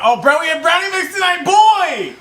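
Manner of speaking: high, loud excited shout; excited outburst. Real, not acted. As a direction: whoop it out, stretching the last word. Suddenly remembers the brownie mix.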